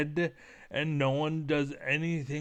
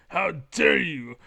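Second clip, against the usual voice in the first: loud, indignant how dare you; hammed-up offended shout; mock outrage; 7 dB louder than usual. Shouting how dare you at the cheesy opponent, mock outrage.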